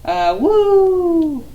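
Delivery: high, loud howling woo; dog howl. A narrator's reading. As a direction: throw the head back and howl like a dog. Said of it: "she howled".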